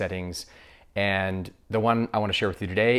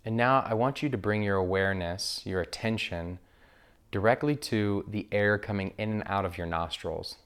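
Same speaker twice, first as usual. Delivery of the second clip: guided meditation. Acted. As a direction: guide listeners gently into breath awareness, unhurried.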